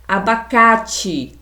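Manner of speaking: "Abacate" slowly, stressing the open á in ca; open vowel. Shown as a demonstration. Teacher models an example for pupils, "Abacate" slow with open stressed á. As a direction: stretch the word "Abacate" with a wide-open ca. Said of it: "pronunciado com a boca aberta".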